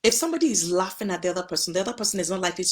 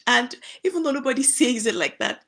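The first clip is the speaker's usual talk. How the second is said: laughing as she talks, higher and louder; real amusement; 4 semitones higher, 6 dB louder than usual. Real, not acted. Wife recalling husband's funny word, laughing. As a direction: let a laugh break into the words.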